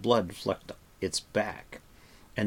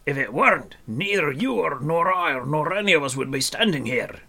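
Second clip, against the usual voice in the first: irritable lecture, raised and loud; snapping dwarf; 7 semitones higher, 6 dB louder than usual. A narrator's reading. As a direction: snap the point home as a cross dwarf. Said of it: "snapped Dolly".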